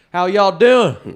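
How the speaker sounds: very loud, high, slow Southern holler; caricatured Southern greeting, bellowed; mock Southern drawl.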